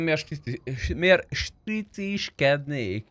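usual speaking voice

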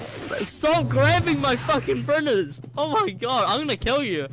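angry yelling. Real, not acted. Raiders grabbing his printers.

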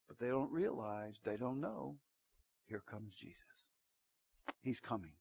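soft and low, almost under the breath; telling the congregation Jesus is coming, hushed; hushed reveal. Acted. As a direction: lean in and let it drop to a hush. Dramatic hush before Christ's return.

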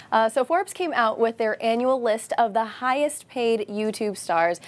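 normal talking voice

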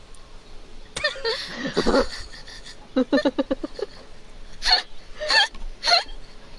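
breathy suppressed laugh; stifled giggle. Real, not acted. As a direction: giggle under your breath. Giggling while hiding.

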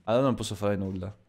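normal talking voice

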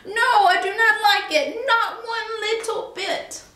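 high, fussy fish voice; a prim little fish, indignant. A narrator's reading. The fish objects to the cat's game, high and fussy. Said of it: "said our fish".

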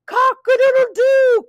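high, stretched rooster crow; crowing cat. A narrator's reading. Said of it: "said the cat".

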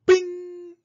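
ringing, high-pitched ping; bell-like bing effect; high imitated bing. A narrator's reading. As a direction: chime it and let it ring. Imitated gadget noises.

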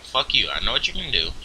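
ordinary delivery

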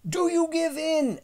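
very high, loud challenge; shouted demand. A narrator's reading. Boy demanding dinosaur's surrender.

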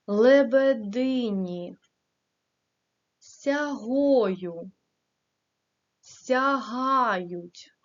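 each word Хвилечка, Плине, Повіває said alone and slowly, stress made clear; careful word stress. Shown as a demonstration. Teacher models words for pupils, each stress said clearly. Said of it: "Правильно ставте голос".

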